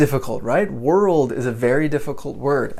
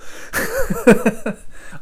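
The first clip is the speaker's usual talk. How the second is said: bright laugh; 11 semitones higher than usual. Real, not acted. Delighted at student reaction.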